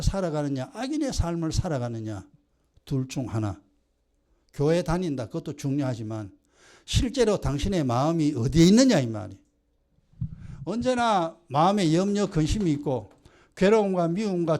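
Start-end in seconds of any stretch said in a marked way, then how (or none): none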